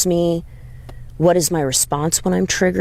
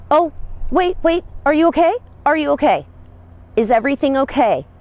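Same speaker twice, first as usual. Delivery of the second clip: much higher, worried are you okay; alarmed concern; 11 semitones higher, faster than usual. Retold or imitated. Walking up to the rider whipping her horse, high worried check-in. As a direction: rush in sounding startled and concerned. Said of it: "I'm doing this".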